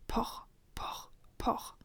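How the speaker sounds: soft, whispered knock sounds; hushed onomatopoeic door knocks; imitated knocking. A narrator's reading. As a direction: voice the knocks very quietly.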